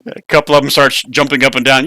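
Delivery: ordinary talk, nothing marked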